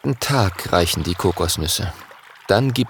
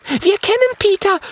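eager call. Acted. Mathilda tells Jim eagerly that they know Peter. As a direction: burst out with it gladly. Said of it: "ruft Mathilda".

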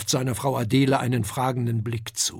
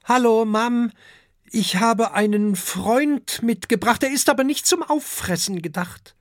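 put-on young dragon voice; 8 semitones higher, 3 dB louder than usual. A narrator's reading. Young dragon greets mother.

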